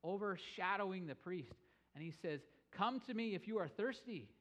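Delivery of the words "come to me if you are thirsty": called out high and loud over the crowd; Jesus rising to call out to the thirsty crowd, loud; loud proclamation. Retold or imitated. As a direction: lift the voice high to overshadow the priest. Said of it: "stands up with a loud voice".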